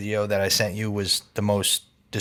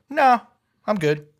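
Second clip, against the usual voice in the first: higher and louder, dismissive no; breezy mock refusal; 11 semitones higher, 6 dB louder than usual. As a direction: brush dad off like it's nothing. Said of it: "look at me and be like".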